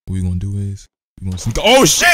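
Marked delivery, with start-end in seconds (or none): 1.6-2.1 loud, high burst of alarm; sudden shocked shout; startled yell. Real, not acted. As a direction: jump out of your skin. Startled while sneaking.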